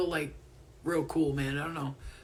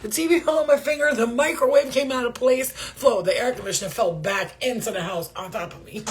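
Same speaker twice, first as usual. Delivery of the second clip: higher, louder, fast list of disasters; exaggerated complaint; 4 semitones higher, 6 dB louder than usual. Making Brie laugh, fast mock disaster list. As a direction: rattle off the mishaps for laughs. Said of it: "Let me just make you laugh".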